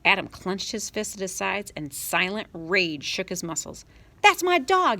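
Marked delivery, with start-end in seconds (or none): 4.2-5.0 high furious scream. Acted. Boy screaming at his sister to let his dog go. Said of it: "he screamed".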